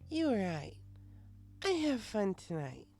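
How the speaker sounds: high, slow tipsy thanks; high, slow drunk mare thanking the bartender; happy drunk. Acted. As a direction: say it warmly, sloshed.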